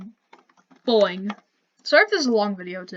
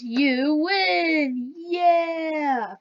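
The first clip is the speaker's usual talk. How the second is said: high, bright "You win! Yeah!" shout; excited victory cheer; 5 semitones higher, much slower than usual. Acted. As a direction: cheer it like you just won. Demoing the game's win message.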